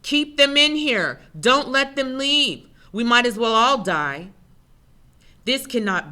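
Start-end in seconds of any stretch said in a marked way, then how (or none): 0.0-4.3 raised, high shout of a fighter in a crowd; screamed shout. A narrator's reading. Others in the dark room yelling to block escape, screamed shout. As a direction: call it out loud and high, urgent. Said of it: "Others in the room scream".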